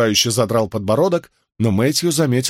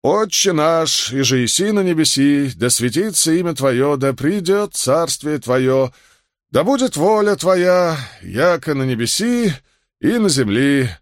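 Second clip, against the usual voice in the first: solemnly intoned Lord's Prayer; reciting prayer; 5 semitones higher than usual. A narrator's reading. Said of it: "начал молитву".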